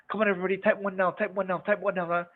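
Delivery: high, fast, nagging presenter voice; mock pushy presenter. Mocking a presenter who nags the audience, high and fast. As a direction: rattle it off like a hyped host. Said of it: "I've seen this and I don't like this".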